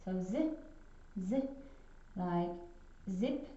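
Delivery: "Z" said as a long buzzing zzz; Z sound demo. Shown as a demonstration. Teacher buzzing "Z" for young pupils. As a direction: stretch the "Z" into a steady buzz. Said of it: "it will sound exactly the same".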